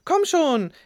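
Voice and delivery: high, loud urging call; rufend. A narrator's reading. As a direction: call her along eagerly. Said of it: "rief Rahim". (laut und hoch rufend)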